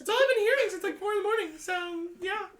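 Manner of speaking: put-on high, frantic young woman's voice; B, panicked roommate. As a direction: play B squeaky and flustered.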